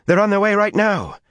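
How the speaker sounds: high urgent panic; alarmed. A narrator's reading. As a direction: blurt it out in alarm. Min breathless with alarm.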